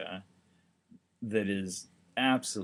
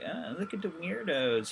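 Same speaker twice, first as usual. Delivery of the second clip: utter disdain; 7 semitones higher than usual. Retold or imitated. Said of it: "utter disdain".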